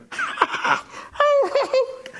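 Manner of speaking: high-pitched mock scoffing laugh; Sarah laughing. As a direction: act out an incredulous burst of laughter. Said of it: "듣고 있던 사라가 어떻게 되냐면". (어이없다는 듯 흉내 내는 높은 웃음)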